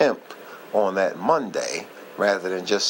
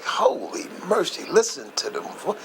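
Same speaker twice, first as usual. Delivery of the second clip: amazed; 8 semitones higher than usual. Retold or imitated. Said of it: "And I'm like".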